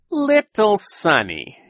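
slow, high, savouring the name; hungry, menacing. A narrator's reading. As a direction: say it like you want to eat her. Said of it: "sounding as if he were eating Sonny".

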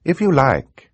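put-on high, polite young girl; confident girl. Girl heroine voiced in narration.

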